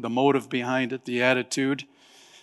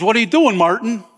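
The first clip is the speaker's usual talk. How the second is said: alarmed; 8 semitones higher, 6 dB louder than usual. Retold or imitated. Mickey alarmed in story.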